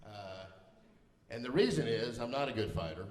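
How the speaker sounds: usual speaking voice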